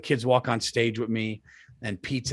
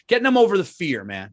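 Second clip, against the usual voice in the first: usual speaking voice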